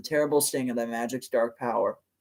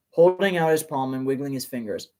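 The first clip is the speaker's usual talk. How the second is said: ordinary delivery, nothing marked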